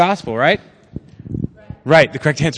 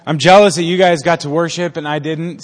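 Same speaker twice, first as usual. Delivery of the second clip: ordinary delivery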